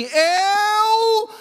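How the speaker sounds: very slow, high, loud and tearful proclamation; God speaking. Acted. God telling Hagar he heard the child cry, very slow loud proclamation. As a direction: draw each word out like God's voice. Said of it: "isso é Deus falando".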